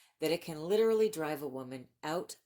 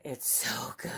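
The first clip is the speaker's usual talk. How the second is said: whispered; 4 semitones lower than usual. Real, not acted. Booktuber confides to viewers about a favorite book, whispered. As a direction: whisper it like a secret you can't keep.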